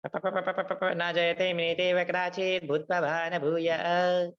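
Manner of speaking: slow, high sing-song rote recitation; parroting a verse. Retold or imitated. Mocking devotees who only parrot slokas, slow and high. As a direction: rattle it off like a parrot with no understanding. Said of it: "just like parrot".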